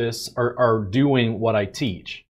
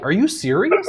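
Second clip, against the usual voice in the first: incredulous; 8 semitones higher than usual. Retold or imitated. Retelling shocked reaction to colleague.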